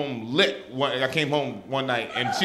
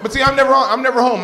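loud, higher insistent protest; emphatic; 11 semitones higher, 10 dB louder, faster than usual. Real, not acted. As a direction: really insist on it. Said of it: "no, seriously".

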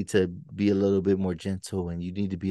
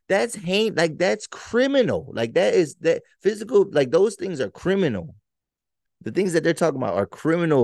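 exasperated outburst; 9 semitones higher than usual. Real, not acted. Podcaster venting about a study on parents, exasperated and high. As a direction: pitch jumps much higher, slowed and emphatic on hate and criminal. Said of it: "it gets me emotional, man, and, and angry".

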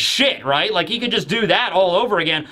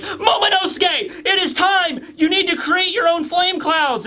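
urgent shout; 10 semitones higher than usual. Retold or imitated. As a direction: yell at him to hurry, pitched high. Voicing Yamato urging Momonosuke.

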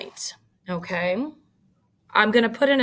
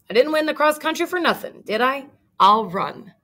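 quick boast; 6 semitones higher, faster than usual. Acted. Spike boasting, rushing off.

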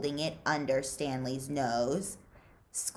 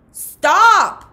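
very loud, much higher, one sharp cry; shouted; 18 dB louder than usual. Acted. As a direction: scream to halt him. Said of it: "shouted Mister Pendanski".

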